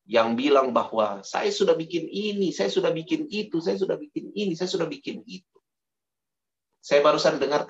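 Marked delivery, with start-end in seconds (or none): 1.3-5.6 boastful Pharisee. Acted. Boastful Pharisee voiced mockingly.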